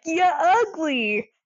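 drawn-out imitated jeer; mock screamed insult. She screams at Amos, drawn-out mock insult. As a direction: stretch it into a mock taunt. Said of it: "and also screams at".

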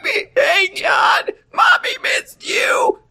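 very high strained screech; screechy voice. Acted. Mother greets little son, in an over-the-top screechy voice. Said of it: "screechy voice".

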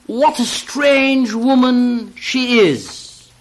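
marvelling. Acted. Narrator marvels at the woman to his friend, slow and amazed. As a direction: savour the wonder of it.

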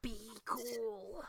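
soft, tight clenched-teeth warning. Retold or imitated. Co-host copying Jillian's warning to Sally, clenched teeth. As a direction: push it quietly through clenched teeth. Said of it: "clenched teeth all the way".

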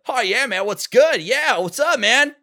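put-on breezy cool-kid Sonic take; Sonic impression. Shown as a demonstration. Voice actor demos his first audition take, upbeat Sonic voice. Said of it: "my Roger Craig Smith Sonic impression".

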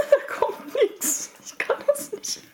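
breathless high giggle; can barely speak for laughing. Real, not acted. Laughing can't burp.

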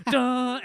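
high, loud mock impact noise; imitated crash. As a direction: boom out the sound of a smash. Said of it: "And you hear, like".

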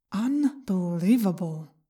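soft, slow, hissing inner voice; whispery telepathic voice. A narrator's reading. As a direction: creep in as a voice in the head. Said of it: "said a voice in my head".

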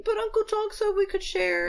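put-on high, small child voice; little Wei Ying pleading. Small boy pleading through the door, high childish voice. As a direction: plead like a tiny hurt kid. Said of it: "Wei Ying is like".

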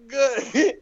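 high, loud, squeezed single word; strained reply. Retold or imitated. Comic just put down from a bear hug, strained. Held aloft, then put down.

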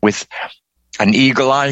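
plain reading voice

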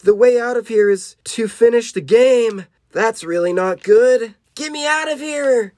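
high, loud storybook shouting; high acted yelling; shouted alarm. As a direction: shout it out, rising into a high cry. Acted shouting between trapped characters.

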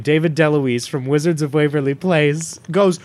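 ordinary talk, nothing marked

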